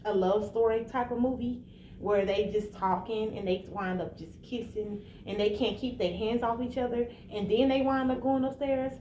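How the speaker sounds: ordinary talk, nothing marked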